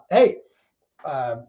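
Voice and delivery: usual speaking voice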